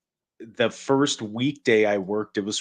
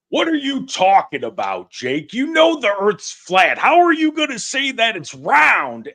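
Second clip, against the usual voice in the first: higher and louder, rising through the rant; loud mock outrage; 10 semitones higher, 5 dB louder than usual. Loud, pitched-up mock disbelief. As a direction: [name] teasing Jake at the Feed the Police event, loud. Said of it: "very loudly go".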